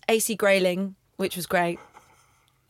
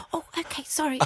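meek apology; 6 semitones higher, 6 dB quieter than usual. Retold or imitated. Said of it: "he went".

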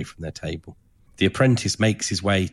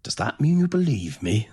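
hopeful master; 7 semitones higher, 3 dB louder than usual. Acted. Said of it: "the master asks hopefully".

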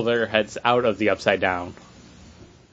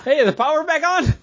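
high mock question. Acted character quote, high.

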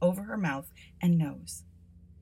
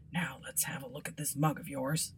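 soft muttering to himself; muttered; 3 semitones lower, 5 dB quieter than usual. Acted. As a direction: mumble it under your breath. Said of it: "muttered to himself".

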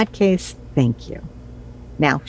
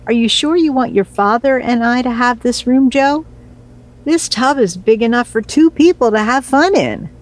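playful teasing; 8 semitones higher than usual. Acted. Mom teasing son about tub.

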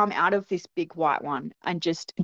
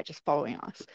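ordinary talk, nothing marked

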